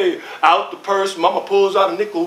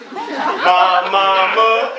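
ordinary delivery

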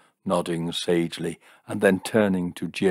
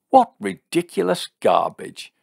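high, loud, scornful outburst; indignant high-pitched yell; shouted; 12 semitones higher, 5 dB louder than usual. A narrator's reading. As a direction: shout it in exasperated disbelief. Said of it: "shouted Jim".

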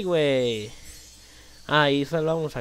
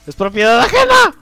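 regaño en broma; 8 semitones higher, 11 dB louder than usual. Pretend.

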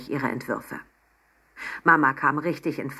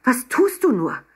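high, loud, exasperated questions; upset reproach; 8 semitones higher, 5 dB louder than usual. A narrator's reading. Mama confronts Emma at the hotel, upset reproach. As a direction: plead and scold at once.

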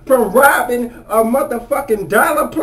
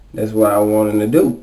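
usual speaking voice

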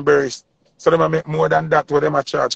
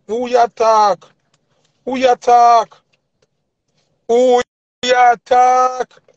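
louder, much higher, outraged yell; angry shout; 8 semitones higher, 5 dB louder, much slower than usual. Acted. Ranting at the rival artists, outraged higher yell. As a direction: shout it out in disbelief. Ranting about an insult to [name].

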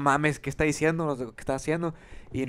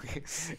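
breathy laugh; 9 dB quieter than usual. Real, not acted. Laughs at his confession.

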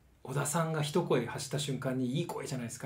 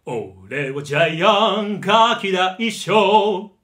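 loud, slow, full-voiced song; the same bully line, rich and full; Gian with a good voice; 5 semitones higher, 12 dB louder, much slower than usual. Shown as a demonstration. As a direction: sing it round and resonant instead of yelling. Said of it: "いいふくよかな低音を持ったらどうなるか".

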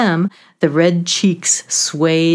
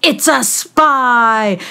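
high, loud and slow alarm cry; alarmed call; 5 semitones higher, 4 dB louder, slower than usual. Acted. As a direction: sound the alarm on the intruder. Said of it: "Two alert newts called out".

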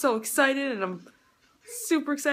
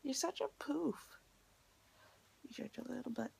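whisper; 3 semitones higher, 13 dB quieter than usual. Real, not acted. Teasing someone at start.

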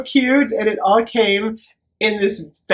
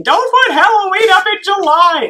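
high, loud mock scolding; imitating mother yelling; 10 semitones higher, 5 dB louder than usual. Daughter mimicking mother forbidding Halloween in July, mock yelling. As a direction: yell it as a nagging mother. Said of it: "My mother's always yelling at me".

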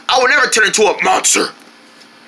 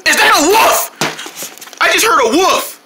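alarmed; 8 semitones higher than usual. Acted. Hears a wolf howling.

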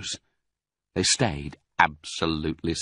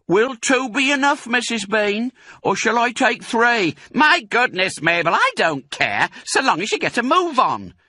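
woman voice; 5 dB louder than usual. A narrator's reading. Women calling between floors.

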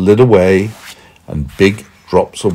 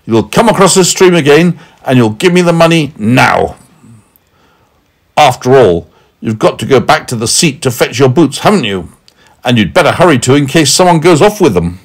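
raised, loud, commanding voice; firm; 6 semitones higher, 5 dB louder, faster than usual. A narrator's reading. Big man ordering Meddle back across, firm. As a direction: lay down the orders firmly. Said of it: "said the big man firmly".

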